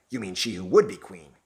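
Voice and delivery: ordinary delivery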